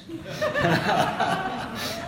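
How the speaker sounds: high, loud burst of laughter; hearty laugh. Real, not acted. Preacher laughing at the Bristolian saying, loud and high. Laughs at his own anecdote.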